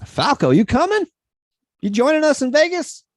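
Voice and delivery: high, loud call across the room. Real, not acted. Inviting Falco to Vegas, calling out loud. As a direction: shout over to Falco.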